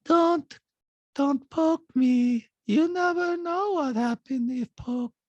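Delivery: high, slow, chilling monotone; detached threat with no inflection; Diana. Retold or imitated. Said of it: "The lack of inflection and all of that".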